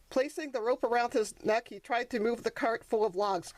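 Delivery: usual speaking voice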